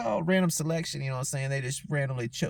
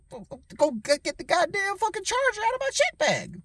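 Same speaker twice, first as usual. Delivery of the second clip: imitating himself, much higher, indignant; retold angry demand. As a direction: bark the order back at the agents. Retold demand to security staff.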